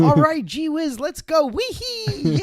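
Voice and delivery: high, gleeful driver squealing wee hee; giddy glee. Retold or imitated. Driver cheering as they pull off, high and giddy. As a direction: bounce it like a kid on a sugar rush.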